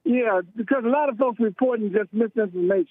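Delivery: call-in caller on the phone; radio show caller. Real, not acted. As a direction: voice over a phone line. Caller on the line.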